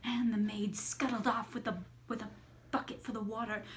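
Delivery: ordinary delivery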